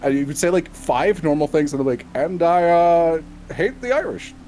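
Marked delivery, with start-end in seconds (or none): 2.1-4.3 lightly voiced statesman, higher and halting; a hammy president hating the Irish, with a laugh; Obama impression. Podcaster doing a bad bit for friends, lightly voiced and higher. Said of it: "since I've done an Obama".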